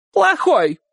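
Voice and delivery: high, emphatic falling cry; shouted confirmation. A narrator's reading. Aunt confirming to Uncle Kolya, shouting. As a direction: shout it back high, as final. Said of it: "крикнула тётушка".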